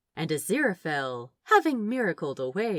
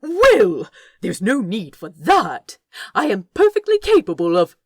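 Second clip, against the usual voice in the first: spluttering with outrage; affronted; 2 semitones higher, 10 dB louder than usual. Acted. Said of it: "Aziraphale gasped at the bare-faced cheek".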